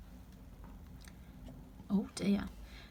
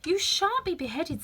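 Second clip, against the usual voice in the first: put-on higher, earnest girl's promise; calm the frightened gardeners; Alice reassuring; 8 semitones higher than usual. Alice promising the gardeners safety, higher and firm.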